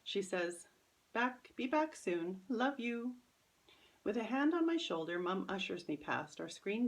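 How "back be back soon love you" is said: higher, slow mom voice, affectionate; mother leaving her aunt, higher and slower; mother's goodbye. Acted. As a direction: linger on the goodbye lovingly.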